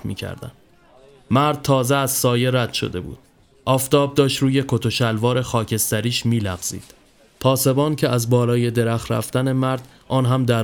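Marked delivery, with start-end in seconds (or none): none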